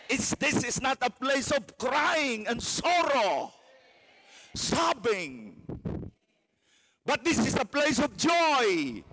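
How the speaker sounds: high, loud triumphant proclamation; preaching at full force. Real, not acted. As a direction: shout the joy to the rafters.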